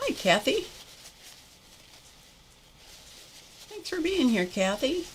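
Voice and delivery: lilting high-pitched hello; high sing-song greeting. Real, not acted. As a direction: greet her brightly in a high voice.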